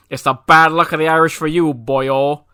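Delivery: fast, loud Irish brogue; Irish accent. Acted. Joke punchline, Irish local taunting the alien in Irish accent. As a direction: throw on a cheeky Irish accent. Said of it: "pretty convincing accent".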